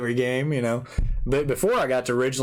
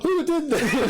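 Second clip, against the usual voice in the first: hammed-up indignant demand, much higher and laughing; mock outrage; 12 semitones higher, slower than usual. Friend teasing, voicing the girl hit by the ball, mock outraged. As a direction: pretend to be the struck girl, laughing. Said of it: "I bet you were like".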